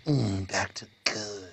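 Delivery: hushed low mock menace; creepy TSA agent. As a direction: savor each word like a sinister agent.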